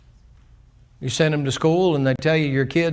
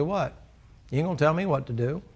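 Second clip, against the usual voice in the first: softer, quick sullen retort; quiet defiance; 7 dB quieter than usual. Acted. A person refuses God's instruction, softer and quick. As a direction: snap back under your breath. Defiant reply voiced again.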